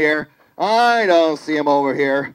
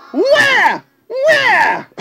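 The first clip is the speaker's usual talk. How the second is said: put-on baby cub wail. Lion showing her baby to the audience, high squalling cub wail. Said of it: "well, here's my baby".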